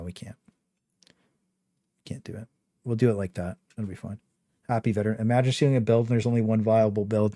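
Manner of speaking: ordinary talk, nothing marked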